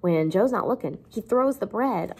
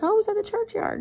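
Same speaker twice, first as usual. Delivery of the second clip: put-on much higher little-boy voice; Pip; faster than usual. Teacher voices the seven-year-old answering his sister, high-pitched. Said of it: "And he says".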